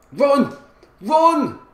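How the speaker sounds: short, high, loud command; urgent shout. Real, not acted. Gamer ordering his player forward, urgent shout. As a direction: holler it as an order.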